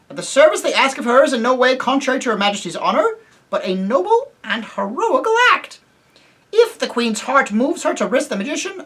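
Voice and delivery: high, formal, courtly voice; gallant mouse knight. Acted. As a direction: speak it like a small proud knight. Reepicheep speaks gallantly.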